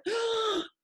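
high sudden intake of breath; imitated sharp gasp. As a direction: gasp like someone coming back to life. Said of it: "And she just went".

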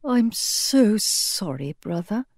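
soft, slow and sympathetic. A narrator's reading. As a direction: comfort a dying brother softly. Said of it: "her mouth twisting in sympathy".

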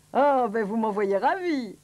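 loud, slow delight; jovial storybook doctor, put on; declaiming doctor. A narrator's reading. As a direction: draw out the satisfaction. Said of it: "déclara le docteur".

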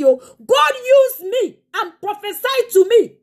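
fiery preaching. Acted. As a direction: proclaim it to the congregation with full force. Preaching at full force.

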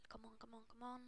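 very soft, low urging; pleading whisper. Real, not acted. Streamer willing the next pack on, pleading whisper. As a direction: whisper it like a private plea.

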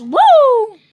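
loud high whoop; excited. Real, not acted. Vlogger cheers loudly into the camera to end a segment. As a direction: whoop it out big.

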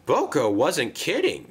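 usual speaking voice

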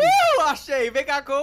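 loud, high triumphant cry; being chased. Real, not acted. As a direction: shout it like you caught someone. Finds hider in hide-and-seek.

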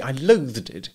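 understated loathing; bitter. A narrator's reading. Wife rejects husband's delight, bitterly. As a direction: let the bitterness show. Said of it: "she said bitterly".

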